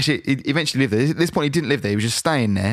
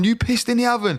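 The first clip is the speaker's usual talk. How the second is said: high-pitched retold accusation; acted accusation; 9 semitones higher than usual. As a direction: re-enact accusing your mate, voice pitched up. Acts out accusing his mate.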